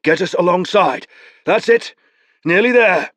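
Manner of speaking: urging on. Acted. Beron urging rowers.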